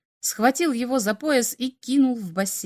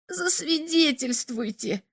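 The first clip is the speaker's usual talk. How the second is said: high, slow drawn-out wail; wailing lament; 6 semitones higher, 3 dB quieter, slower than usual. A narrator's reading. Alien Slop begs Igor for a certificate, wailing. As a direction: stretch it out like a lament. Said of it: "причитал Слоп".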